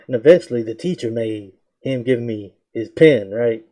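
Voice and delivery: usual speaking voice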